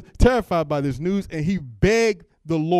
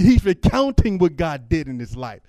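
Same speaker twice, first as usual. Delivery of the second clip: ordinary talk, nothing marked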